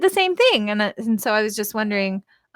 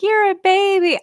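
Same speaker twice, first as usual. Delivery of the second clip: mocking quote; 9 semitones higher, 4 dB louder than usual. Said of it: "when people are like".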